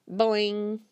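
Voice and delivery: bouncy spoken boing; boing sound. Acted. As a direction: pop out a cartoon boing. Frog jumping noise.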